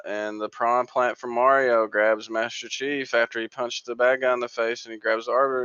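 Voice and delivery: usual speaking voice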